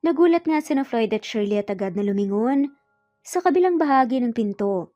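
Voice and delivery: ordinary delivery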